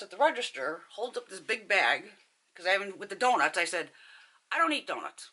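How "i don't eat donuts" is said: high, emphatic retold reply; acted emphatic reply. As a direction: start high on "don't" and drop away. Her own reply at the counter.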